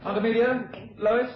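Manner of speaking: high call to the other room. Acted. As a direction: call them in from afar. Calls family from next room.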